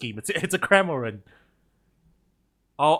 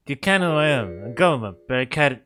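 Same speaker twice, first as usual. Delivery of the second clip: rough, hammed-up Scottish attempt; Scottish accent; 4 dB louder than usual. Governor asking if visitor knows him, rough Scottish accent. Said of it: "it's definitely Scottish".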